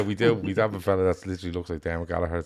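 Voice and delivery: usual speaking voice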